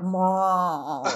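mock tearful whimper. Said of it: "And I'm like".

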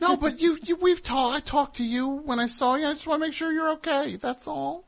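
fussy worried voice. Pretend. Fussing over the caller in a high mock-worried voice. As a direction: coo over her like a fretting relative.

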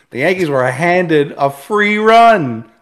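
loud, slow, outraged emphasis; indignant. Real, not acted. Outraged about umpire.

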